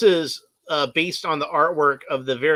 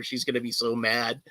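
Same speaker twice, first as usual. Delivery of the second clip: usual speaking voice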